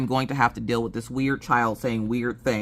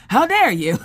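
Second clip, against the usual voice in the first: louder, higher through laughter; laughing mock outrage; 9 semitones higher, 6 dB louder than usual. Wife pretending to be offended, laughing. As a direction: fake offense while cracking up.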